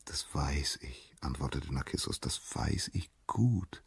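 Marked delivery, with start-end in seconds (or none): 0.0-1.1 slow, near-whispered self-satisfaction; hushed and self-absorbed; smug murmur. A narrator's reading. Vain Narcissus answers, self-absorbed. 2.2-3.8 slow, near-whispered self-satisfaction; hushed and self-absorbed; smug murmur. A narrator's reading. Vain Narcissus answers, self-absorbed.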